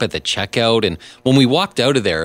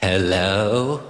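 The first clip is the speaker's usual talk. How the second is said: put-on possessed child voice. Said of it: "you gotta sound like a possessed child from the thirties".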